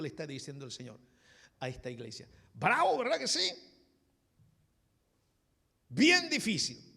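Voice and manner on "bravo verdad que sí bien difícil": high, loud preacher's outburst; emphatic exclamation. Real, not acted. Preacher reacting to the Lord's harsh message, exclaiming loudly. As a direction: call it out to the whole congregation.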